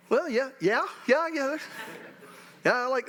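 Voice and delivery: high, fumbling yeahs; awkward agreement. Retold or imitated. Father fumbles for words over his daughter's rose, high and awkward. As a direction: fake enthusiasm you don't feel. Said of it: "And I'm like".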